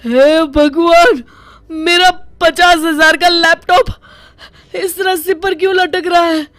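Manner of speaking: high, wailing shock; stunned dismay. Acted. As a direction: cry out in horror at the sight. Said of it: "आंखें फटी की फटी रह जाती है".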